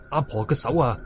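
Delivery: ordinary delivery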